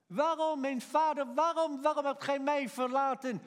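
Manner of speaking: high, loud anguished cry; cry of forsakenness. Acted. Jesus on the cross calling to his father, anguished cry. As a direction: cry out why, sustained and pleading. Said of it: "Het was jouw kreet die hij uitroep".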